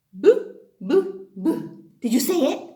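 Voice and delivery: ordinary talk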